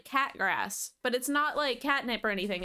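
ordinary talk

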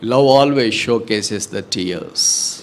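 very slow, weighty statement; slow pulpit proclamation; slow solemn maxim. Acted. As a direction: lay down the truth slowly.

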